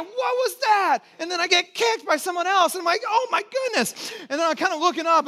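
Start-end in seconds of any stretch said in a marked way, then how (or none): 0.0-1.0 much higher, alarmed question; startled. Retold or imitated. Pastor reliving a kick in the race, startled cry. 3.1-4.1 high, slow, stretched-out dismay; alarmed. Retold or imitated. Pastor recalling being kicked, slow high alarm. Kicked again while swimming.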